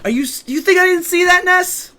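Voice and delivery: high, loud incredulous accusation; mock outrage. Streamer calls out Ness over the game, indignant. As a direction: build to an indignant high-pitched shout.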